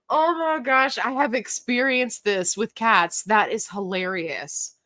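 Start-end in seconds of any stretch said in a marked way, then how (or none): none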